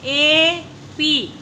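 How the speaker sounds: slow phonics blending; drawn-out blending. Shown as a demonstration. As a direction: stretch एपी very slowly, letter by letter. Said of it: "क्या बन गया ये".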